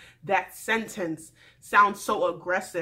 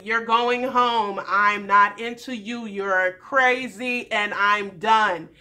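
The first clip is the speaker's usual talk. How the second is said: slow, deliberate dismissal; slow, firm mock verdict; 5 dB louder, slower than usual. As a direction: spell it out slowly and firmly.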